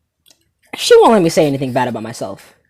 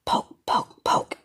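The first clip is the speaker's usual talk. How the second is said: whispered pokes; 8 dB quieter, much slower than usual. Retold or imitated. Imitating the poking.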